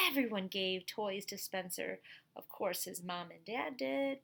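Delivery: put-on lively storybook voice for kids; reading to children. A narrator's reading. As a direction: read it out to the class like a picture book. Reads picture book to class.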